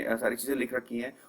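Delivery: usual speaking voice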